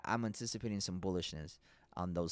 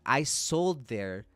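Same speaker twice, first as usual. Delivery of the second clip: slow emphatic mock quote; 4 semitones higher, 7 dB louder, much slower than usual. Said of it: "He's like".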